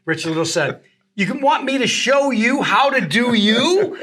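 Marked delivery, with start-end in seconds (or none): 1.1-4.0 high, loud, drawn-out mock disbelief; incredulous. Impressionist retells colleague asking his guest, in mock incredulity. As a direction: stretch the question upward, on the edge of laughing.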